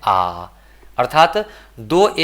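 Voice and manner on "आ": आ drawn out, lower in pitch; long vowel demo. Shown as a demonstration. Teacher isolates the sound in the word, long vowel demo. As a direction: hold a long, low आ. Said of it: "बा में क्या है".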